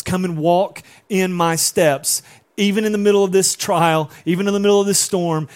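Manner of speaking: high, loud pleading invitation; impassioned preaching. Acted. Pastor voicing Jesus calling Peter, impassioned. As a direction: call them out onto the water.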